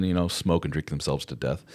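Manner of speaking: usual speaking voice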